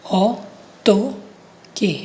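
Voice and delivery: ऑटोके very slowly, as ऑ, टो, के; syllable by syllable. Shown as a demonstration. Teacher showing learners how to say it, slow syllable-by-syllable ऑटोके. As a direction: break ऑटोके into three drawn-out syllables with pauses. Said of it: "इसको बोलूँगा मैं".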